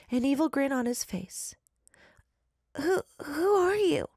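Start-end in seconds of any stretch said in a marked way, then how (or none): 2.8-4.1 scared stammer. Acted. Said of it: "Alice demanded".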